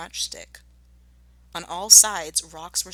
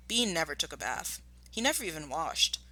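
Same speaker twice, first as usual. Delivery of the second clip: ordinary delivery, nothing marked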